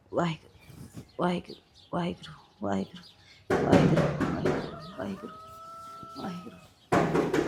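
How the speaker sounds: softly murmuring Waheguru over and over, half whispered; a mother's quiet prayer chant, barely voiced; murmured prayer. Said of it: "mummy ਪਾਠ ਕਰਦੇ ਸੀ".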